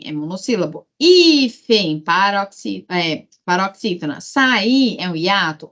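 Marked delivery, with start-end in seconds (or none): 1.0-2.0 HÍÍfen, HÍ held and pushed; calling the word. Shown as a demonstration. Teacher testing for oxytones, calling the word. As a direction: call out Hífen, leaning on HÍ. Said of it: "chama a palavra".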